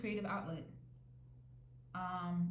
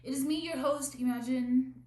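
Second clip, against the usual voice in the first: higher, louder grand host announcement; host intro; 6 semitones higher, 8 dB louder than usual. Shown as a demonstration. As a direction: announce yourself like a showbiz host.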